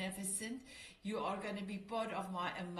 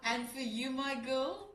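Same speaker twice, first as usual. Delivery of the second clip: ordinary talk, nothing marked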